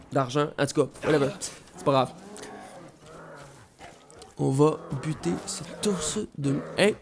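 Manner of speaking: ordinary talk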